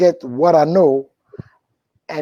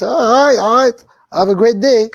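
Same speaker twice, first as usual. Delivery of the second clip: high, loud dismissive sign-off; louder, higher, eager to be rid of them; mock brush-off; 7 semitones higher, 7 dB louder than usual. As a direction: cut them off with raised pitch and volume. Brushing off the talker, retold.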